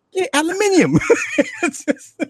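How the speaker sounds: high, gleeful shout breaking into laughter; laughing. Acted. Guest retelling their love of the word, laughing. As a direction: crow "aluminium" while cracking up.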